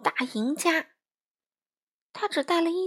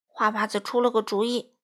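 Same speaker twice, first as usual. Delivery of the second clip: ordinary delivery, nothing marked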